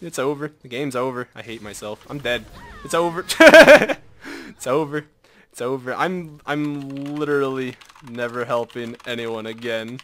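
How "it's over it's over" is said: normal talking voice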